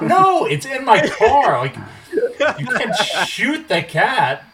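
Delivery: high, loud, slow disbelieving objection; exasperated protest. Retold or imitated. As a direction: protest in laughing alarm at the brother. Brother asks to shoot it.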